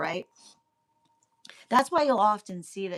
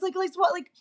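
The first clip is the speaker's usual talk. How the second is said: ordinary delivery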